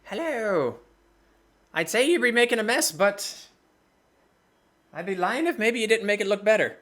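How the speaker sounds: quick, chatty merchant; sly, flattering shopkeeper. Acted. DM voices shopkeeper Bilver.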